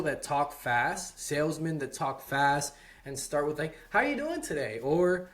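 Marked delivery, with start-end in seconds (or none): 3.9-4.8 "How you doing today?" ends on a high, rising pitch; upward inflection. Shown as a demonstration. Fast-talking salesman greeting a prospect, high rising tune. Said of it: "a upward inflection".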